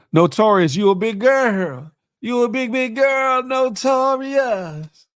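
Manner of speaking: high, drawn-out mock teasing; playful teasing. Real, not acted. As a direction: tease him, stretching the words. Teasing a guest on stream.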